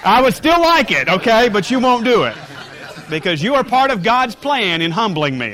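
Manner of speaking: high, loud laughing confession. Real, not acted.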